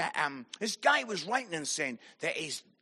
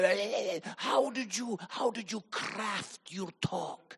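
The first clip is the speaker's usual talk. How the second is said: softer, self-important questioner, slowing at the end; mock pompous; 7 dB quieter than usual. Leader asking the speaker a pompous question, mock-pompous. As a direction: puff up the question grandly. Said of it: "a pompous question".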